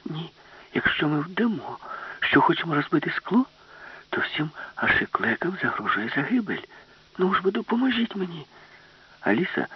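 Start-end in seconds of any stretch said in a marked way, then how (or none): 0.1-8.5 hushed, higher urgent whisper; speaking quietly. Acted. Alice explains her plan quietly so the ship's brain won't hear. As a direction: explain the plan under the breath. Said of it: "намагалася говорити тихо".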